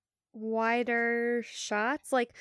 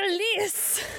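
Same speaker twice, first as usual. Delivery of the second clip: Scottish accent, rolled R; 9 semitones higher than usual. Shown as a demonstration. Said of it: "she says release in a Scottish accent".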